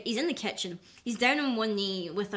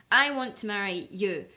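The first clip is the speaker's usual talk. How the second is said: slow, louder mock suitor voice; pompous suitor; 6 dB louder, slower than usual. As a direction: ham up the eager parson's proposal. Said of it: "and he's like".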